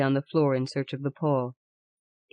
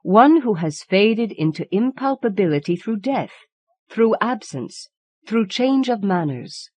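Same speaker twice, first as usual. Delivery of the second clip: tingling energy; 6 semitones higher, 5 dB louder than usual. Acted. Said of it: "with tingling energy".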